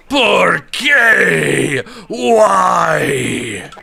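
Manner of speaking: high, loud wailing shout of Por qué; anguished cry. Retold or imitated. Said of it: "That's what I say when I walk in".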